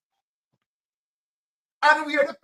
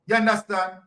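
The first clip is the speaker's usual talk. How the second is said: ordinary talk, nothing marked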